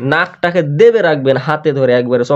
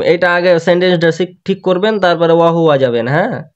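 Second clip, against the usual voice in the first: ordinary talk, nothing marked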